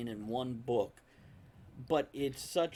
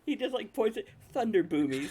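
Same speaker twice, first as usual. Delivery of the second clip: high-pitched British voice; 10 semitones higher, 6 dB louder than usual. Retold or imitated. Retelling the strongman's voice.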